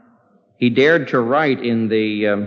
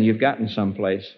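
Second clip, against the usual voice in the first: ordinary talk, nothing marked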